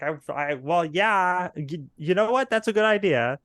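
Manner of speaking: rising, emphatic in-character approval; acted enthusiastic agreement. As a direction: play someone suddenly sold on an idea. Game master voices character's delighted reply.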